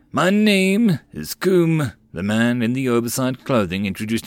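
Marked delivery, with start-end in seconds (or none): 0.1-1.9 merchant voice. Acted. Merchant introduces himself.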